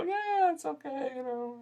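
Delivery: a bored moviegoer shrugging it off; lukewarm shrug. Retold or imitated. Critic mimicking an average viewer, high lazy shrug. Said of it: "You're just gonna go".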